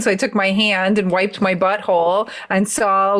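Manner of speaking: normal talking voice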